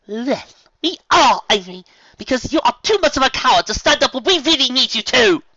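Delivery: high, loud cutting voice; growling Rarity. Acted. Rarity rejecting Fluttershy, loud growl. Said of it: "the Fashionista growled".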